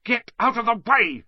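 loud, high snap; snapping. A narrator's reading. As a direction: snap it at him. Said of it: "snapped Ron".

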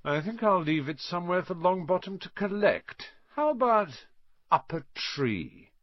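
high, teasing malice, slowing at the end; smirking, spiteful taunt; nasty gloating. A narrator's reading. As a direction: toy with your victim. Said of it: "Malfoy smiled nastily".

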